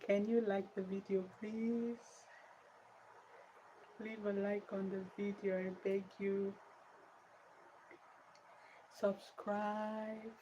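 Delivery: high-pitched pleading beg. Real, not acted. Streamer begging viewers to like the video, pleading.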